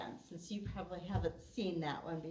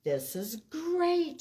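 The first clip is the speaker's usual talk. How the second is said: higher, louder, slow delighted voice; higher, louder friends' voice; friends delighted; 8 semitones higher, 7 dB louder, slower than usual. Acted. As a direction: marvel at the treehouse. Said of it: "says his friends".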